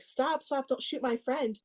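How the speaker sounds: high, softened cartoon plea; mock pleading. Casper begging the hunter for Ferdy, mock pleading.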